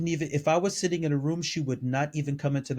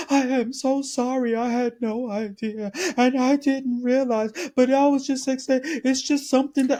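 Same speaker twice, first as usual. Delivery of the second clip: mock teary apology; 8 semitones higher than usual. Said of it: "She was in tears".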